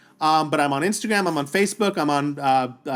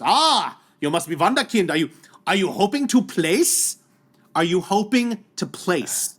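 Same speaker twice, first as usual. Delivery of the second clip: mock German accent, drawn-out question; German athlete; slower than usual. German athlete quizzing a first-timer, mock German accent. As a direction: imitate a German rival sizing up a newcomer. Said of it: "German athlete".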